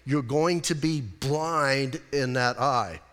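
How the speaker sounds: imitated doctor making sure it sinks in; slow, emphatic explaining. Doctor to patient who isn't understanding, slow and emphatic. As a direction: spell it out slowly, word by word. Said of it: "you're not understanding".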